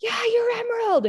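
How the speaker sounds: high shouted reply, imitating her son; yelling back. Son yelling back at mom through glass, high yell. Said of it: "we're yelling at each other".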